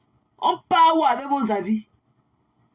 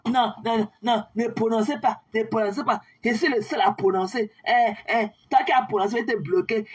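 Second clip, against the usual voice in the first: normal talking voice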